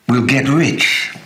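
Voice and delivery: high, loud cry of hope; slow, ringing call of old friends; youthful shout. Retold or imitated. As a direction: shout it like two boys dreaming big. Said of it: "the way we used to shout".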